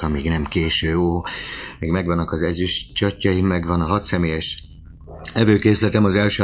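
ordinary delivery

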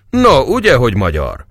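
high, loud opening that drops at the end; triumphant cry. Acted. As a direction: crow it in triumph. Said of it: "kiáltott az asszony diadalmasan".

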